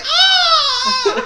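very high, loud mock scream; hammed-up scream. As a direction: scream like a horror movie victim. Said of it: "someone did this good".

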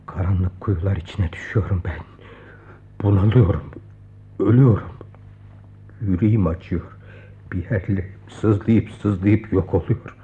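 low, broken, half-whispered lament; anguished despair. Acted. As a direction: sink into despair. (derin, kırık bir çaresizlik)

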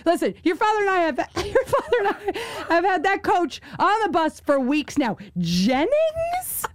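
high, mock-exasperated mother breaking into laughter; mom going off. Daughter imitating her mom's rant about the coach, exasperated. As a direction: imitate an indignant mom, soaring on the last word. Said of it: "she went off".

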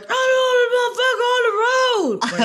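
very high, loud yell through a car speaker; yelled over speaker. Retold or imitated. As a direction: holler it like a director on a crackly car speaker. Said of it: "we heard, like".

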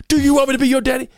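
loud, high, fast drill sergeant bark; drill sergeant yell. Retold or imitated. Drill sergeant yelling at the kid on stage. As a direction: shout it like a drill instructor. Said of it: "he was just, like".

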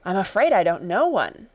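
usual speaking voice

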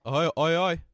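loud mock greeting call; Colin's greeting. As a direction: bellow his hoi hoi greeting. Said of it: "That's how he greets people".

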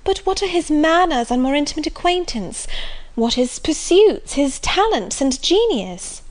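lightly voiced eager Marianne; eager young girl. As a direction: ask it like a romantic young lady.

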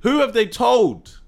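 slow, high, drawn-out question; pressing. Real, not acted. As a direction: stretch out each word as a challenge. Pressing the point in argument.

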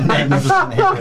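high laughing mock admiration. Real, not acted. DM laughs at the hair joke, high and giggling. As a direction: gush through laughter.

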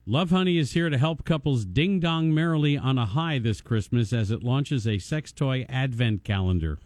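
ordinary talk

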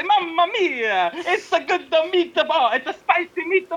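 high, slow cartoon Italian; mocking Italian accent. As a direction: mimic her as a hammed-up Italian chef. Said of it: "no, you say".

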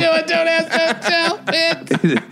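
taunting sing-song slogan; mock slogan. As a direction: chant it like a cheeky soldier. Chanting the slogan joke.

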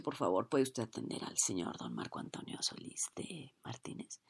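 hushed, breathy whisper; whispered manager. Retold or imitated. Manager whispering to the cashier about the star. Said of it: "el gerente me dice".

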